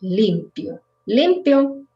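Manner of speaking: Limpio with the stress on LIM; stress first syllable. Shown as a demonstration. Teacher repeats the adjective Limpio, first-syllable stress. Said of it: "accent in the first one".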